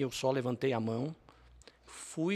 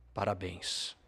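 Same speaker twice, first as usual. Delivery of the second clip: low, soft mock robber; dry congratulations from the robber; 6 semitones lower, 3 dB quieter, slower than usual. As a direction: say it low and offhand, like a thief's sarcasm. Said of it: "E aí ele falou".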